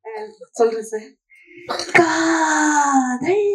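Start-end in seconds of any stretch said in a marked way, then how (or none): 1.9-3.4 slow stretched wailing shout, rising; overdone lovesick wail; passionate cry. Pretend. Thinking of his uncle, he cries out love. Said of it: "அவர் மட்டுமே நினைத்து சொல்லுங்க".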